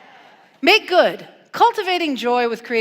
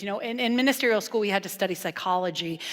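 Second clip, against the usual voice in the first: usual speaking voice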